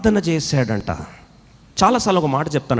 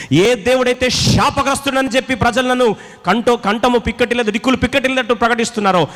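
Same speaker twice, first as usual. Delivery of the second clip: fervent preaching; 8 semitones higher than usual. Acted. Preacher builds to a climax.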